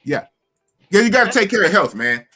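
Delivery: normal talking voice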